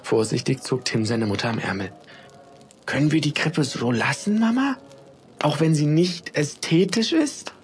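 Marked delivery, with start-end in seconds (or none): 2.8-7.5 put-on high hopeful child's plea, Mama rising sharply; a boy timidly begging his mother; pleading boy. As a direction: ask sweetly for a yes.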